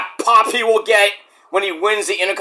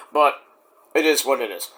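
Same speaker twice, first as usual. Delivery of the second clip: ordinary talk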